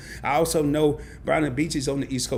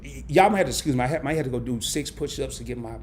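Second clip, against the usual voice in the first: ordinary talk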